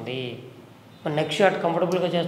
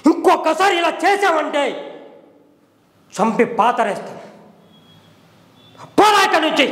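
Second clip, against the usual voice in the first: furious demo; 10 semitones higher than usual. Shown as a demonstration. Acting teacher shows a cheated man's furious outburst, shouting. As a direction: roar the betrayal in earnest rage. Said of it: "ఇప్పుడు చెప్తా, dialogue".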